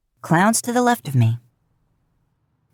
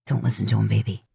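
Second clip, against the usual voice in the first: cooing to the car; 7 semitones lower, faster than usual. Acted. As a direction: whisper it to the car like a pet. Dean soothing his car.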